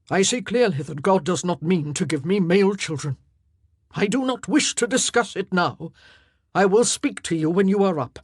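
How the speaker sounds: a lightly voiced king shutting her out; cold king. A narrator's reading. King dismisses wife after miscarriage, firm.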